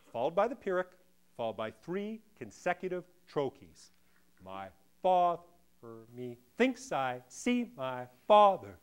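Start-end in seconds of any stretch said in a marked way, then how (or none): 4.4-8.8 slow "My father me thinks", beat by beat; dissected metrical stresses. Shown as a demonstration. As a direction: pound the stressed syllables, "father", "thinks", "see". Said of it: "Let's dissect Shakespeare's line".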